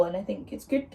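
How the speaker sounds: ordinary talk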